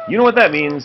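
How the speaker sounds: lightly voiced mother rabbit, a touch brighter; a hint of Missus Cottontail talking to her child. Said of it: "said Missus Cottontail".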